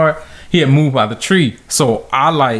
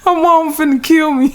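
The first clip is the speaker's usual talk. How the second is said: high, panicked squeal of dread; 12 semitones higher than usual. Retold or imitated. Kid dreading his mom, in a high panicked squeal. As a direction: squeak it out like a kid who knows he's doomed. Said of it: "I was like".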